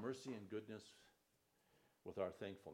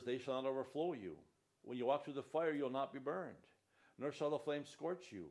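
louder, steady declaiming of scripture; scripture reading; 6 dB louder than usual. Acted. Pastor reading Isaiah aloud to congregation, declaiming. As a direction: read it out like a promise to the hall.

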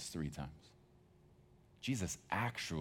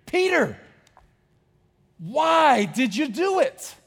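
loud, much higher, drawn-out anguished rebuke; stern rebuke; 18 dB louder, slower than usual. Pretend. Imagined Jesus rebuking Peter, loud and slow. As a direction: cry out the rebuke, slow and hurt. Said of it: "I would hear Jesus say".

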